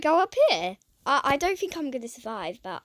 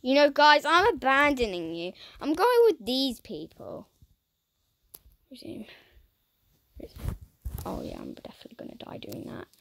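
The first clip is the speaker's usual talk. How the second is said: ordinary talk, nothing marked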